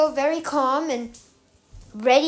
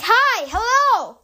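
drawn-out hopeful shout, higher and louder; yelling back; 8 semitones higher, 5 dB louder, slower than usual. Retold or imitated. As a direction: call out across the hills to the rescuers. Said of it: "So I start yelling back".

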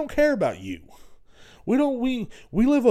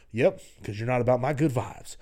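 ordinary talk, nothing marked

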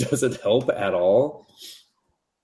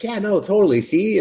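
much higher, bubbly mock girl; high girly Cupid voice; 11 semitones higher, 3 dB louder than usual. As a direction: squeak it out like a carefree cartoon girl.

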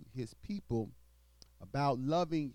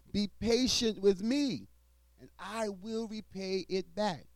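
scripture reading; 9 semitones higher than usual. Acted. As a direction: proclaim the verses to the congregation. Reads scripture aloud in sermon.

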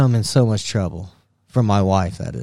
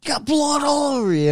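mocking the wife. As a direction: ham up his wife's exasperated wail. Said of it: "And then she's like".

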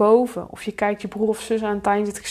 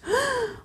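anxious gasp; 6 dB quieter than usual. Acted. Falling into fear.

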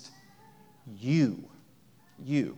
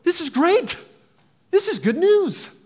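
very high, loud mock celebration; exaggerated cheering; 14 semitones higher, 9 dB louder than usual. Shown as a demonstration. Preacher showing the congregation how to cheer, loud and hammed-up.

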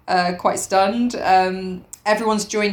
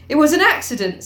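sudden higher-pitched burst; suddenly; 7 semitones higher, 4 dB louder than usual. Acted. Piggy seizing on an excuse, sudden. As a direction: blurt it out, pitch raised. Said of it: "said Piggy suddenly".

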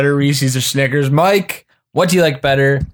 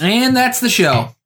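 high, drawn-out host's outro; mock show sign-off; 10 semitones higher, slower than usual. Co-host cuts off the argument with a high, drawn-out mock sign-off. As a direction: wrap it up in a slow, lifted sing-song.

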